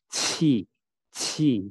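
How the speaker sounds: "ci" with a strong burst of air; aspirated q. Shown as a demonstration. Teacher shows Q has air too, aspirated "ci". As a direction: puff air through the start of "ci". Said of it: "Ini juga ada udaranya".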